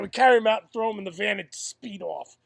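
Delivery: usual speaking voice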